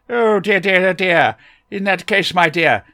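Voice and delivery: eccentric Doctor voice. A narrator's reading. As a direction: bustle in as a fussy, kindly eccentric.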